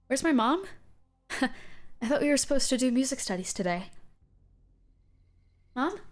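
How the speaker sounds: put-on high, frightened lost child; scared kid, high and pleading; younger voice. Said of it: "younger voice going".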